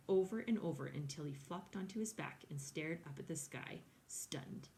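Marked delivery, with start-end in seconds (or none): none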